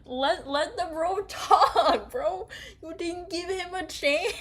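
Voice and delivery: high, slow yell through laughter; laughing yell. Real, not acted. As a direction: shout at the character while cracking up. Yelling at June, laughing.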